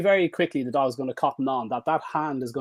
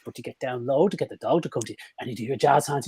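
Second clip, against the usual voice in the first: ordinary talk, nothing marked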